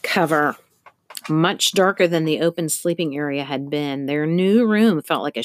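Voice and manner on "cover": ordinary delivery, nothing marked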